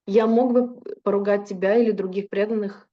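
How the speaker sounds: ordinary delivery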